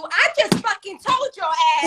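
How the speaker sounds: high, loud acted snap; exasperated parent outburst. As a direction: blow up as the parent who has asked repeatedly. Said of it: "After the third, fourth time, it turns into".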